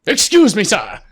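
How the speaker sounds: sudden high, loud polite call; polite butler. Pretend. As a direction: startle them with courtesy. Butler pops up, startling player.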